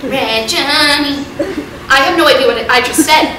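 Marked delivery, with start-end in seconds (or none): 0.1-1.3 slow, low, drawn-out Red Johnny; dad's catchphrase. Retold or imitated. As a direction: croon it like a goofy dad. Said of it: "Just like that".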